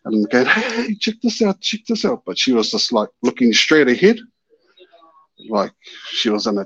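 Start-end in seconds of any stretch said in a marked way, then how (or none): 0.5-2.2 high-pitched excited nudge to look; reenacted eager calling; retold urgent call. Urging companion to look.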